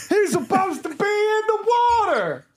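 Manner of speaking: high, hammed-up horrified Southerner; over-the-top Southern horror, very high-pitched. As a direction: wail it in a cartoonish Southern accent. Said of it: "completely stereotypical Southern accent".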